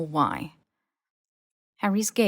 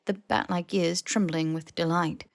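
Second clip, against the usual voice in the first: ordinary delivery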